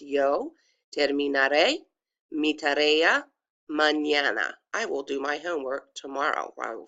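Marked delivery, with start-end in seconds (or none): 0.0-4.5 careful Spanish, stress on the final é of "terminaré"; pronunciation demo. Shown as a demonstration. Teacher repeating the sentence for students, careful demo. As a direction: say "yo terminaré mi tarea mañana" in separated pieces, every letter sounded. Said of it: "it's pronounced".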